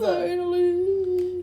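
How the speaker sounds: long, loud high cry; drawn-out cheer. Real, not acted. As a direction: stretch the cheer out. Character finally appears.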